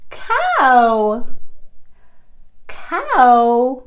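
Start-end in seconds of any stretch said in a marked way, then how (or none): none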